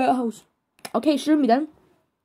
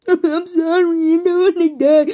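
mock sobbing; 4 semitones higher than usual. Victim begging not to die, sobbing. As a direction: bawl the apology in hammed-up despair. Sobbing apology before dying.

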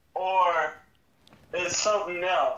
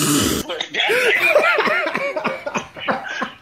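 high relieved laugh; 3 dB louder than usual. Real, not acted. Prank revealed, friend laughs.

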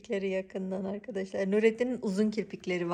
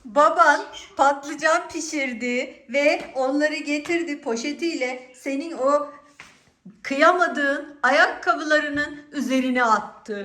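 slow teasing; 6 semitones higher, 7 dB louder, slower than usual. Pretend. Mother teasing son, slow.

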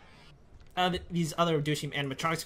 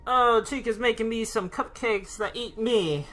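normal talking voice

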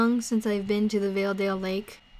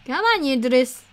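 louder coaxing call to a straggler; raised voice, urging on; 3 semitones higher, 6 dB louder than usual. Pretend. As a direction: call the companion along. Calling to lagging companion.

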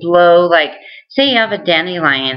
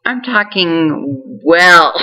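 amused; much slower than usual. Real, not acted. Joking about own speech progress.

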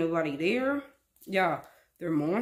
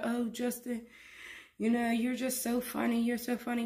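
lightly voiced wife, higher and sweet; mocking the wife; 5 semitones higher, 3 dB quieter than usual. Vlogger imitating the wife praising Justin, mock sweet. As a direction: mimic her forced flattery. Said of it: "And she'd be like".

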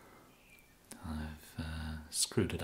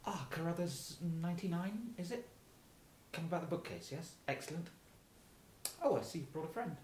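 higher, put-on genteel welcome at the door; a sugary higher doorway welcome; overly polite host; 10 semitones higher, 6 dB quieter, faster than usual. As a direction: greet them sweetly like a butler. Kidnapper plays polite host at door.

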